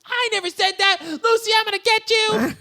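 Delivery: put-on very high-pitched girlish protest; mock protesting shout. Retold Lucy threatening her tattling friend, mock shout. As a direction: imitate an outraged kid calling out.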